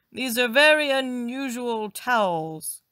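loud, slow, puzzled call. A narrator's reading. Husband washing his hands calls out loudly, slowly. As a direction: call it through from another room. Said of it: "he called".

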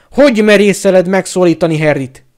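outraged shouted challenge; furious roar. A narrator's reading.

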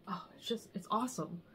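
breathy hushed wonder; awed whisper. Real, not acted. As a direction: whisper it lovingly. Admiring the drape.

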